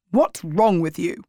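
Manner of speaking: plain reading voice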